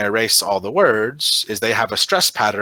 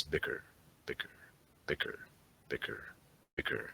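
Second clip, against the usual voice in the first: barely audible murmured repetitions; quietly to oneself; 7 semitones lower, 21 dB quieter than usual. Shown as a demonstration. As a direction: murmur it to yourself, pausing between repeats. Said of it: "say bicker to yourself five times quietly".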